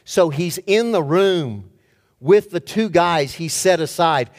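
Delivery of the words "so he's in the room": ordinary delivery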